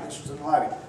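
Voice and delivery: ordinary talk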